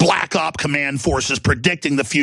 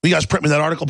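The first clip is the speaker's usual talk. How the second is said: usual speaking voice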